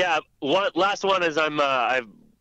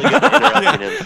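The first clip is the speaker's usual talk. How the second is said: quick, higher, louder and laughing; laughing tease; 9 semitones higher, 9 dB louder than usual. Real, not acted. Friend praising the host's interrupting, laughing. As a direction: tease him while giggling.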